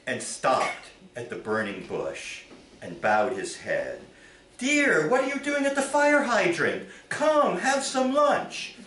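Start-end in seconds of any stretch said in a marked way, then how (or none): 4.6-8.7 much higher suburban wife voice, laughing a little; wife calling husband to lunch, puzzled; high bright-free wife's call with a laugh; nagging wife. Acted.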